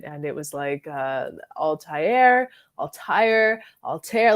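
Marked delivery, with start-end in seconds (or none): 1.6-2.5 Altair as "al-TIE-air"; three-syllable pronunciation. Shown as a demonstration. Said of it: "different ways to pronounce it". 2.8-3.6 Altair as "al-TIRE"; "tire" pronunciation. Shown as a demonstration. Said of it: "different ways to pronounce it". 3.8-4.3 Altair as "al-TAIR"; "tear" pronunciation. Shown as a demonstration. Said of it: "all these different ways to pronounce it".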